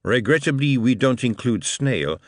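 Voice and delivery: put-on lightly French-accented host; a polite French aristocrat greeting; French Marquis. Marquis replies.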